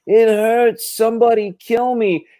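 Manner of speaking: high, strained pained wail; man in pain. Retold or imitated. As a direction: whine it like a patient in agony. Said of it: "he was like".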